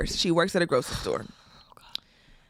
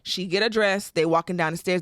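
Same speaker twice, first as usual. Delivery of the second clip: ordinary delivery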